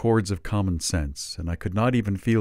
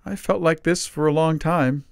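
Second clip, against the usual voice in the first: a hint of a higher frail old voice; lightly voiced old man; 10 semitones higher, 4 dB louder than usual. A narrator's reading. Father Christmas answers Dickens, lightly frail. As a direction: sigh it out, tired.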